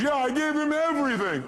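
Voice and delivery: high mock wail; distraught caller. Retold distraught caller complaining.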